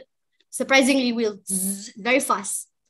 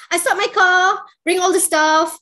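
loud, hurried and urgent; excited retelling; 6 semitones higher, 7 dB louder than usual. Retold or imitated. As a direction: relive the rush to set up. Rushing story climax.

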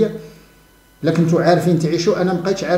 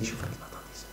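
whispered mocking aside; scornful whispered jab; 22 dB quieter, faster than usual. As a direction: breathe it under your voice with scorn. Mocking aside about believers.

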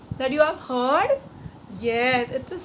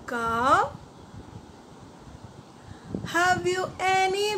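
slow, sing-song line-by-line rhyme; call-and-repeat recital; 4 dB quieter than usual. Shown as a demonstration. Teacher leading children to repeat the rhyme, sing-song and slow.

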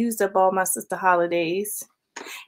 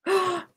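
gasp; 7 semitones higher, 7 dB quieter than usual. Real, not acted. Sees bracelet is broken.

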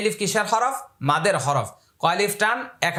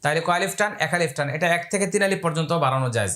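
ordinary talk, nothing marked

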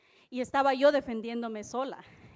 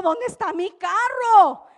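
alarmed cry; 8 semitones higher, 11 dB louder, slower than usual. Retold or imitated. Finds her car gone.

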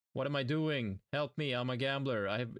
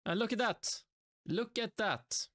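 much higher, thrilled look at that; excited; 11 semitones higher than usual. Real, not acted. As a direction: show it off, voice jumping up. Excited at new skin.

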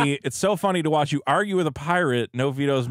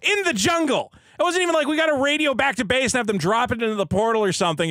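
incredulous; 9 semitones higher than usual. Real, not acted. Incredulous rant about movie.

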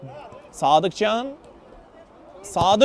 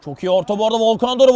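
ordinary talk

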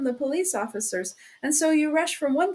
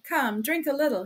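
ordinary delivery, nothing marked

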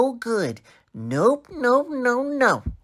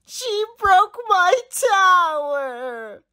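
slow, high tearful wail; crying; 8 semitones higher, slower than usual. Acted. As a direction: wail out your complaint, dragging the words. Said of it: "because I'm crying".

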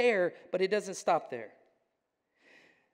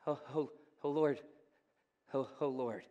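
humbled awe; 6 semitones lower, 8 dB quieter than usual. Retold or imitated. Peter falls to his knees.

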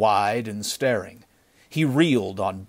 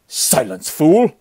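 angry snarl; 6 semitones higher, 9 dB louder, slower than usual. A narrator's reading. Chief cutting off his fellow bandit, snarling. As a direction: snarl him into silence. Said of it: "snarled the chief".

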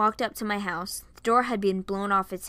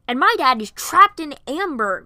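loud, raised comeback; angry retort; 7 semitones higher, 13 dB louder than usual. A narrator's reading. Varian retorts about his own trapped dad, angry. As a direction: fire it back forcefully. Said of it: "Varian retorted, his anger returning".

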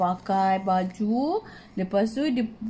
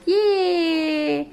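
cheer; 5 semitones higher than usual. Acted. Cheering the ending.

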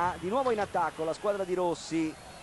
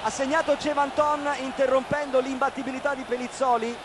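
breathless italian goal commentary; 3 semitones higher, 3 dB louder than usual. Real, not acted. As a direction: celebrate the scorer, voice raised.

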